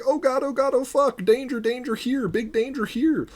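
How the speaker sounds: very high-pitched frantic alarm, acted out; mock panic. Imitating opponents reacting to danger, high mock panic. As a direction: squeal a hammed-up danger warning. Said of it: "They're gonna be like".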